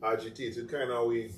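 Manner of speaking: ordinary talk, nothing marked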